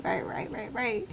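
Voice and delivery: steeds zachter, uitgeput; weary fading. Real, not acted. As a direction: laat het moe wegzakken. Exhausted trailing repetition.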